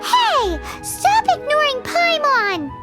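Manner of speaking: put-on squeaky, childlike indignant cry; high, drawn-out cartoon outburst; indignant protest. Companion ignored by the ally, indignant high protest.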